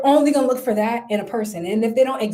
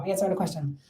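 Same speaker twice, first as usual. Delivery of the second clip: quiet aside; 6 semitones lower, 8 dB quieter, much faster than usual. Real, not acted. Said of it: "I'm not crazy, y'all".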